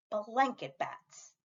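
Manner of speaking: ordinary delivery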